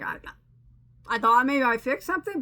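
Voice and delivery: normal talking voice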